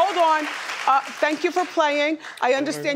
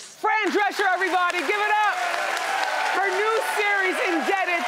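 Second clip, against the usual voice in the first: high, bright host plug; show host hype; 7 semitones higher than usual. Acted. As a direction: hype the guest to the crowd. Talk-show host hyping the guest.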